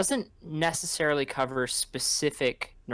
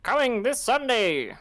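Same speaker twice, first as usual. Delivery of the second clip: higher, louder announcer hype; fighting game announcer; 10 semitones higher, 4 dB louder than usual. Acted. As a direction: boom it out like a promo. Movie trailer announcer bit.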